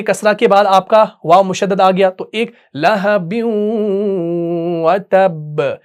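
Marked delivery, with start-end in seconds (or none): 2.1-5.8 लहबिन व तब slow, the nasal merged into the व; idgham shown. Shown as a demonstration. Teacher demonstrates idgham to students, slow and careful. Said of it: "अब देखिए यहाँ पर इदगाम हो गया".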